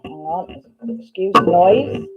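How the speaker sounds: usual speaking voice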